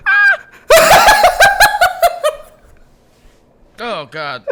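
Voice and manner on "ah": high squeaky shriek into laughter; squirrel shriek. Acted. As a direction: shriek then crack up.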